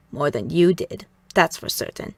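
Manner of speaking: low, creaky put-down; raspy Mother. A narrator's reading. Mother belittles the girl beside her kimono, in a low rasp. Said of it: "in that raspy voice of hers".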